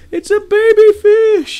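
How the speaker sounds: very high, loud drawn-out squeal; re-enacted excitement. Owner retelling how he found a baby fish, excited squeal. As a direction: squeal the discovery, stretched and loud.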